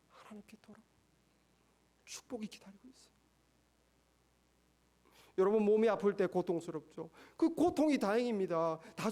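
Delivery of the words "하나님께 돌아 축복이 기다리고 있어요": breathy hushed promise of blessing; a soft secret whispered from the pulpit. Acted. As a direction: lean in and whisper it to the congregation.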